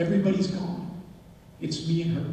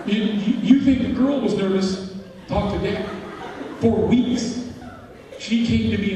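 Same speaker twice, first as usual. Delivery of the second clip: laughing; 4 semitones higher, 4 dB louder than usual. Real, not acted. Laughing at awkward memory.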